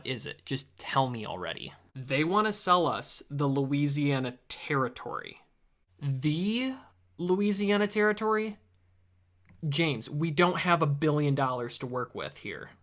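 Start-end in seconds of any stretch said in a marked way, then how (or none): none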